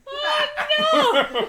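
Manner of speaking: laughing. Real, not acted. Laughing reaction to shirt.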